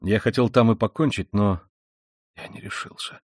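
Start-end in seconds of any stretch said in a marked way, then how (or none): 2.4-3.2 breathless, voiceless whisper. A narrator's reading. Raskolnikov confessing to his sister in a whisper. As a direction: breathe the words out with no voice. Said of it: "прошептал он".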